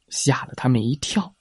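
plain reading voice

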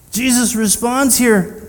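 ordinary talk

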